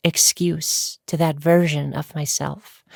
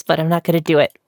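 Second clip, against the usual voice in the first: usual speaking voice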